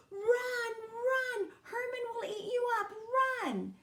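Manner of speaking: put-on old man shrieking a warning; panicked warning. A narrator's reading. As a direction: yell it in terror.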